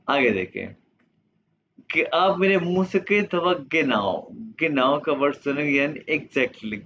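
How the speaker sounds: normal talking voice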